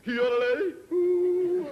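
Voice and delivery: very high, loud yodelled call; search party yodel. Acted. Search party calling up to the skier, loud high yodel. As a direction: belt a yodel across the mountains. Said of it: "they send out a search party".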